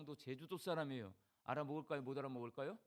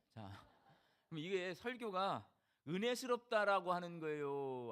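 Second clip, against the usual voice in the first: ordinary talk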